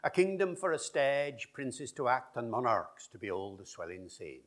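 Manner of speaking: OP Shakespeare with "stage" said stedge; original pronunciation. Shown as a demonstration. Linguist answers the RP reading in original pronunciation. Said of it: "an example of R P versus O P".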